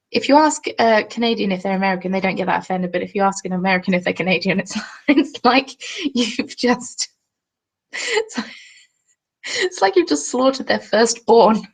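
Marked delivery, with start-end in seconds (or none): 4.6-11.7 talking through giggles; laughing. Real, not acted. Streamer recalling offended Americans to viewers, laughing. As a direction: struggle to get it out for laughing.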